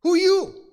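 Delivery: "Who you" shouted high as one blunt burst; forceful, to the point. Shown as a demonstration. Boaz wants to get to the point, "Who you" punched hard. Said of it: "He really wanted to get to the point".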